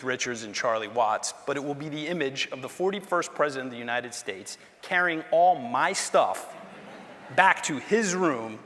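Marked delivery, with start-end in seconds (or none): 4.8-8.6 slow, deliberate, each word weighed; slowed for emphasis. Real, not acted. Telling the audience about the president carrying his bags, slowed and deliberate. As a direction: let it land slowly. Emotional climax of tribute story.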